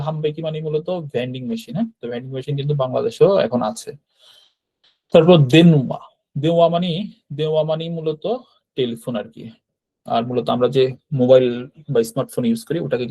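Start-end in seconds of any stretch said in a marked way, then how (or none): none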